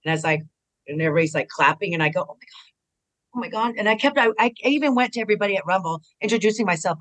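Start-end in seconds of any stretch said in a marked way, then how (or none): 2.2-3.8 breathless awed whisper, then a higher gasp; retold stunned murmur; hushed oh my God. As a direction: let it slip out under your breath in awe. Overwhelmed, hearing her number called.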